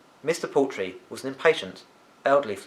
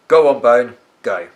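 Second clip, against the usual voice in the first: a loud impatient grunt of dismissal; 11 dB louder than usual. Acted. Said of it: "Mister Poultry grunted".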